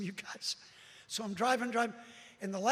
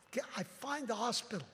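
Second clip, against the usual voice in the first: ordinary talk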